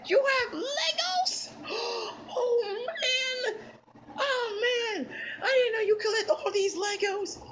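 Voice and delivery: high-pitched gasping excitement; thrilled, very high kid voice; awestruck delight. Acted. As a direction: squeal over every new toy. Child discovers a big Lego collection.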